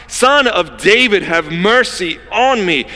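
high, loud, slow plea; crying out. Acted. Beggar by the roadside begging Jesus, desperate loud cry. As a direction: cry out in desperation, stretching each word. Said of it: "he's the one who is crying out".

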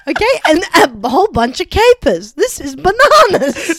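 loud, high indignant rant; hammed-up furious customer; mock outrage. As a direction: pile on the complaints with comic force. Playing outraged restaurant customer.

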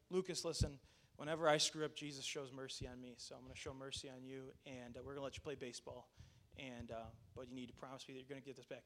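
soft, low, sincere fatherly talk; hushed, earnest reassurance; quiet mercy. Retold or imitated. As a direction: gently let him off. Father quietly grants his son mercy.

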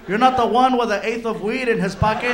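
loud scornful taunt from the back; mocking yell. Felipe yelling at the crying driver from the back seat, loud. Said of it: "Felipe's in the back seat going".

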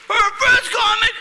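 loud, fast, high crowd hype; shouting announcer energy. Real, not acted. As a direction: pump the room up like an MC. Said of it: "host hyping the crowd".

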